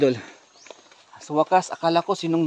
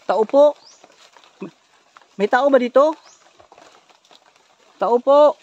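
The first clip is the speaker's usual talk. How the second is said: loud, raised searching call; 5 semitones higher, 6 dB louder than usual. Acted. Asking if anyone is here, calling out. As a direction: call out to whoever might be there.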